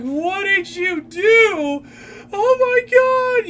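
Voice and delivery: high, loud, drawn-out shriek; mock-hysterical yell, stretched long; imitated screaming. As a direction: scream it like you're freaking out. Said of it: "screaming and yelling at the top of her lungs".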